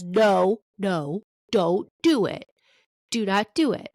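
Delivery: firm, slow insistent no; emphatic warning. Real, not acted. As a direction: plead firmly, leaning on each word. Warning parents against flotation devices.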